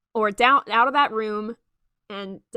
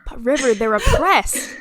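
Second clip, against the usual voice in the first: laughing; 10 dB louder than usual. Real, not acted. GM jokes to River while still laughing. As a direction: joke said through laughter. Laughing through a mock defense.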